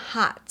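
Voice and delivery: 'hat' as 'haht', mouth wide; American open vowel. Shown as a demonstration. Teacher quoting American friends, open 'ah' in 'hat'. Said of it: "เพื่อน American กีเขาจะพูดว่า".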